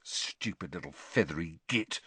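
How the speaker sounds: low, soft angry hiss. A narrator's reading. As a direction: hiss the insult under your breath. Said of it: "Ron hissed".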